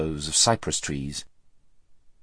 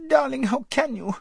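put-on very high, girlish squeal of protest; high squeak. A narrator's reading. Frightened girl protesting to her friend, squeaky. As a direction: squeak it in dismay. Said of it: "squeaked Lazareline".

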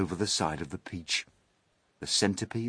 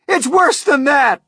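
very high, loud cry; horrified cry; 13 dB louder than usual. A narrator's reading. Police chief topping the fire chief's fear, horrified cry. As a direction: cry out in rising panic. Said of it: "cried the chief of police".

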